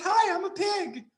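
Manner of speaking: cartoon squeaky pig greeting; high-pitched pig. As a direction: chirp it like a tiny squeaky pig. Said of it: "high-pitched pig".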